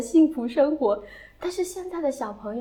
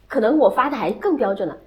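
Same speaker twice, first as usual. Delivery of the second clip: normal talking voice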